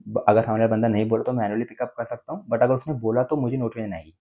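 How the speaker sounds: usual speaking voice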